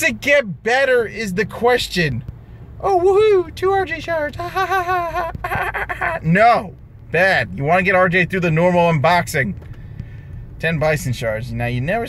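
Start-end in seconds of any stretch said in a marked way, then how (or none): 2.8-6.2 high mock celebration with fake laughter; sarcastic cheer. Real, not acted. Gamer mocking a tiny shard reward, sarcastic high laugh.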